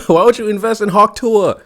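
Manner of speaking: high, loud laughing disbelief; incredulous shout. Real, not acted. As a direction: shout the question, amazed anyone fell for it. Baffled by the crypto loss.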